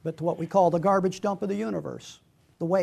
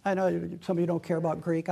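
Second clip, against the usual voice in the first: ordinary delivery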